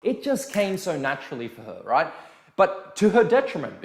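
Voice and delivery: normal talking voice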